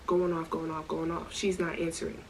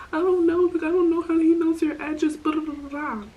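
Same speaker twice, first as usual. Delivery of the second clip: much higher and louder, frantic; imitating panicking; 9 semitones higher, 4 dB louder than usual. Panicking friend defending herself to the host, higher and louder. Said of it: "now she's, like, panicking".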